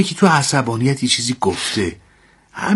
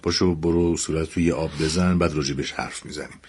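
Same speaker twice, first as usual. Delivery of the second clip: much softer and lower, gently settling her; soothing quiet; 8 semitones lower, 6 dB quieter than usual. Acted. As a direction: hush her down softly so she'll wash up. Husband calming his crying wife.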